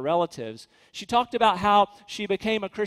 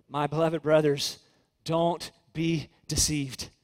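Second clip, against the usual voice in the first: lower, slowing to a gentle, spaced-out plea; tender appeal; 3 semitones lower, slower than usual. Acted. James to his beloved brothers, tenderly warning them. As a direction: plead tenderly, taking your time. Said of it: "James says tenderly".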